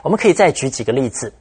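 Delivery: ordinary delivery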